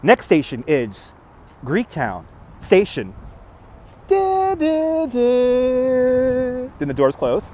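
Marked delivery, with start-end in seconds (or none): 0.0-3.1 slow, low announcer voice; a hint of a train announcer; train announcement. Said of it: "ride the Detroit People Mover". 4.1-6.7 high sung door chime. Retold or imitated.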